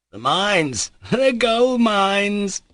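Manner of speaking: very high, loud, slow laughing shout. A narrator's reading. Mitya shouting to Pyotr Ilyich about gold mines, laughing. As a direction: bellow it out and burst into laughter. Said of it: "shouted at the top of his voice".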